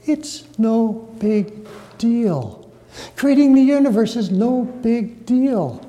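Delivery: lightly voiced, slow and offhand; God/character. Preacher speaking as God about creation, lightly acted. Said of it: "It's as though God is saying".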